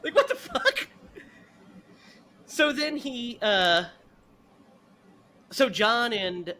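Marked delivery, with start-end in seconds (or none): 0.0-0.9 incredulous. Real, not acted. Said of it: "own reaction".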